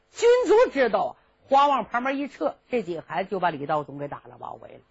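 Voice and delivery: ordinary delivery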